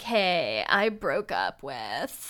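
creaky vocal fry guy voice; lightly voiced creaky vocal fry. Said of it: "You're using so much vocal fry".

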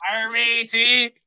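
high, loud, drawn-out cartoon pirate. Pirate hailing a matey, hammed-up pirate voice. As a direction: hail a shipmate as a hammy pirate. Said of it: "You sound like a pirate".

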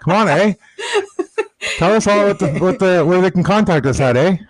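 playful jab in a put-on Canadian accent; teasing wife in an overdone Canadian accent; mock Canadian accent. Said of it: "try to do it with a Canadian accent".

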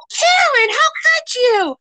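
high, slow wail of a shocked mother; a mother crying out her daughter's name in disbelief; shocked mom. Retold or imitated. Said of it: "The mom's like".

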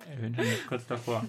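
muffled by a hand over the mouth. Real, not acted. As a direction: talk through a hand over your mouth. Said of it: "Die hält mir den Mund zu".

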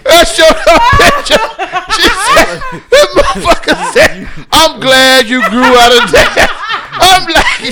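high, loud laughing retelling; cracking up mid-story; laughing hard. Real, not acted.

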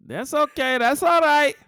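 loud, high-pitched mock Mexican accent. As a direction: belt it out in a put-on Mexican accent. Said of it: "Mexican accents".

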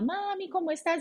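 lightly voiced children calling their mom; child's greeting. Children greet mother on video call. (saludo de niño con vocecita más aguda y suave)

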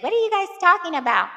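high, loud, fast imitation of mom; indignant mom. Mom interrupting daughter and stepdad, mock indignant. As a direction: butt in quickly, pitched right up.

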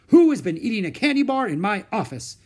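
a doctor demanding an answer from the room; doctor sniffing out candy, demanding loudly. Acted. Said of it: "he demanded".

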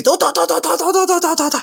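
high staccato "to to to" like a toy machine gun; sleepwalking kid spraying imaginary gunfire, high and rapid; mock gunfire. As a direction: fire off each "to" like bullets from a shooter game. Sleepwalking, imitating game gunfire.

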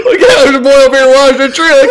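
loud, high trucker shouting over radio. Pretend. Trucker calling on CB.

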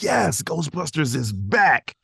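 high, slow, gleeful cheer; thrilled. Retold or imitated. Fan hearing Ghostbusters is back, high slow glee. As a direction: savour it like a fan's dream come true. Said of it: "And I'm like".

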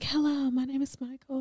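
mock his tiny whispery voice. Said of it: "You're not like".

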